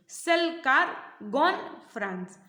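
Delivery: सेल, car, gone, France as slow separate words; content words only. Shown as a demonstration. Teacher showing a sentence stripped to key words, word by word. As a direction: deliver each key word alone with a gap after it. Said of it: "if you remove the structure words from a sentence".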